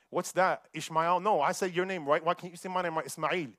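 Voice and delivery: ordinary delivery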